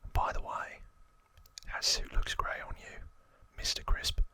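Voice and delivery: lightly acted, whispered Cockney gang boss; Cockney accent. Gang boss telling a wannabe how to rule, whispered Cockney accent. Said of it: "That's my best Cockney accent".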